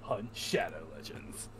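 deep slow announcer voice. Acted. Deep slow mock repeat.

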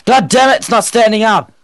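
loud frustrated yelling; raging gamer. Real, not acted. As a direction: blow up at the stubborn dog. Dog won't stand, furious.

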